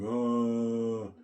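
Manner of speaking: long, drawn-out mock wail; held moan, acted out; imitated crying. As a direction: imitate a wife's wailing cry. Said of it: "I can barely hear my wife cry".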